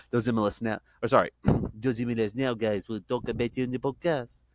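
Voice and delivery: mock French accent on a quote. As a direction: imitate the coach in a French accent. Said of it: "That was my best French accent".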